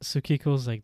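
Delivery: plain reading voice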